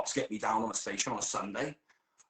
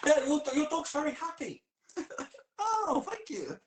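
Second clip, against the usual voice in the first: high, louder cheerful voice; high chirpy reply; 4 dB louder than usual. Retold or imitated. Joking about a happy dog, high and chirpy. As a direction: play it bright and high.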